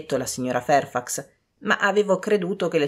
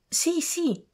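excited cries; 13 semitones higher, 3 dB quieter than usual. A narrator's reading. Young people agreeing together, excited high cry. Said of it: "gridarono tutti insieme".

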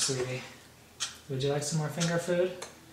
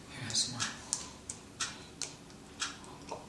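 ordinary talk

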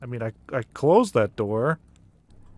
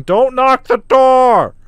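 high, loud, drawn-out yell; acted shout from a diary; 9 semitones higher, 11 dB louder, much slower than usual. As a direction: shout it out as a desperate warning. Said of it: "and I'm shouting".

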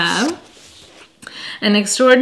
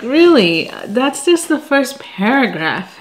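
sarcastic disbelief; 5 semitones higher than usual. Retold or imitated. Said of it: "And I'm like".

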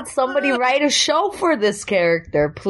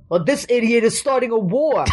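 imitated New York neighbor; crazy neighbor; 3 semitones higher than usual. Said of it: "crazy neighbor that kinda talks like that".

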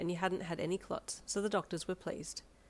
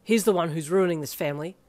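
put-on loud spoilt-child protest; indignant pout; petulant; 10 dB louder than usual. A narrator's reading. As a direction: whine the accusation loudly. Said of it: "acting like a spoilt five-year-old".

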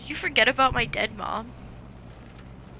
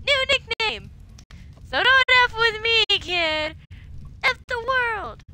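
put-on drunken defiant shouting; 8 semitones higher, 3 dB louder than usual.

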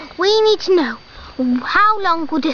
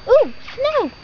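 surprised gasp; 10 semitones higher, 4 dB louder than usual. Acted. Sudden alarm at eruption.

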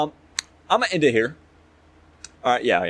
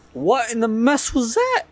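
scared horror game streamer; 11 semitones higher than usual. Real, not acted. Startled by a sound in game.

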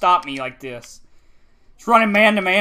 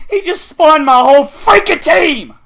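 slow, loud, higher-pitched yell; real exasperated shout; furious outburst; 6 semitones higher, 7 dB louder than usual. Real, not acted. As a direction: explode in angry disbelief. Bursts out after muttering.

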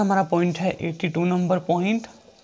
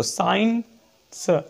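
slow, drawn-out word; teacher dictating an example; slow dictation; much slower than usual. Shown as a demonstration. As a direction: stretch the word for the class. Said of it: "जैसे पहला आपका है science".

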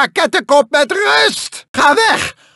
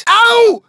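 pained yelp; 6 semitones higher than usual. Acted. Character crying out when hit, yelping. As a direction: yelp as if struck.

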